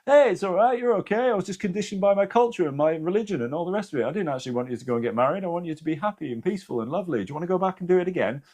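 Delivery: high, fast, gentle dad; dad's voice. Retold or imitated. Anita's late dad reassuring her on the other side, in a high gentle voice. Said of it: "her dad was just like".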